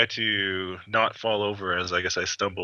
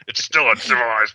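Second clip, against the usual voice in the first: growling; 6 dB louder than usual. Acted. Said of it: "You will hear me growl".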